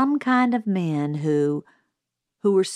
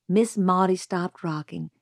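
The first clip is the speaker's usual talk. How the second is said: ordinary delivery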